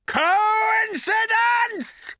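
high, slow, shouted sneer; mocking shriek. A narrator's reading. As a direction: shriek it with scorn. Agrajag mocking cry.

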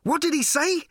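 high, loud breathless question; panting. Acted. Ford elbowing through the crowd, panting loud question. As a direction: gasp it while running. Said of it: "panted Ford".

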